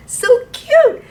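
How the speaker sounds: very high, loud, laughing "so cute"; delighted squeal at the birds; giggly squeal. Real, not acted. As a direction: gush over it with a laugh. Teacher about chirping birds.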